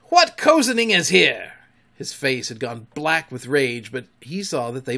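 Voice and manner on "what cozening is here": high, loud, furious demand; raging pirate captain's bellow; thundering. A narrator's reading. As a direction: thunder it at the crew. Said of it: "thundered Hook".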